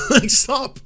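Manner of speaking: very high, loud squeal through laughter; laughing exasperation. Real, not acted. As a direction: burst out laughing while begging. Laughing plea to stop.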